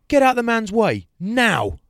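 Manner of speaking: high, loud, slow command; shouted order. Retold or imitated. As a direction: roar it as an impatient husband's order. Husband orders his wife aside.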